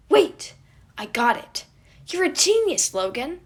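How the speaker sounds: loud, high eureka shout; excited. A narrator's reading. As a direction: burst out with the idea. Sudden idea, very loud.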